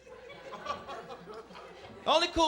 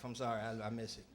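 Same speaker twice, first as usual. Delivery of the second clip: much softer, under the breath; sheepish quiet confession; 12 semitones lower, 12 dB quieter than usual. Real, not acted. Confession aside.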